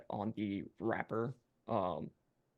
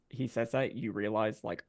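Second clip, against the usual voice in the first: ordinary delivery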